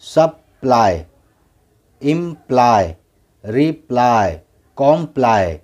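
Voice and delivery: सप्लाई, इम्प्लाई, रिप्लाई, कॉम्प्लाई each ending in eye; final y as eye. Shown as a demonstration. Teacher shows the rule's exception, सप्लाई to कॉम्प्लाई ending in eye. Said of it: "लेकिन यहाँ क्या पढ़ रहे हैं हम".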